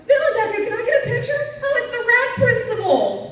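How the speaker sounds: high, loud squeal of a convention fan; excited fan. Retold or imitated. Fans spot cosplayers while they walk around, excited high-pitched shouting. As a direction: call out in a high, thrilled voice.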